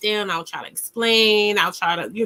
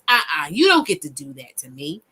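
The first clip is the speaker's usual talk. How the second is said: righteous voice; 4 semitones lower than usual. Shown as a demonstration. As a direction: stand your ground from the diaphragm. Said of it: "You hear that? ... That's your righteous voice".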